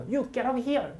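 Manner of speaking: high mock "You get out of here" shouted at a kid; teacher ordering the troublemaker out. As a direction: throw the kid out, loud. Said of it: "그걸 빨리 발음해요".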